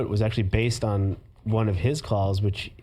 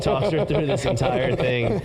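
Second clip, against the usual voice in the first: normal talking voice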